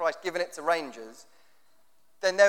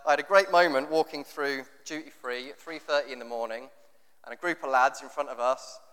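usual speaking voice

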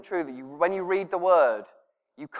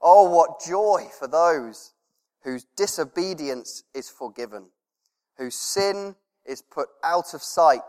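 slow, louder scripture proclamation; psalm reading; 4 dB louder than usual. Acted. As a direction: read it like a triumphant psalm.

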